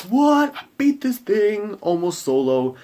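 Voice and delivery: imitating a thrilled player, high squeal easing down; high, loud mock-amazed boast; pumped up. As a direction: crow it in triumphant disbelief. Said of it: "He's all pumped up".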